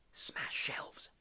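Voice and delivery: urgent whisper through barely moving lips; hissed whisper. A narrator's reading. Harry secretly hissing an order to Hermione, whispered. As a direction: hiss it low so no one else hears. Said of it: "Harry hissed to Hermione".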